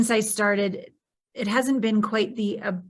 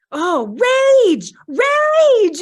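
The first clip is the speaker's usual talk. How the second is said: much higher, louder shout of rage; raging shout; 13 semitones higher, 11 dB louder, much slower than usual. Retold or imitated. Voicing rage aloud on the massage table, high shout. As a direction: let the anger burst out during massage. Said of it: "I am literally saying out loud".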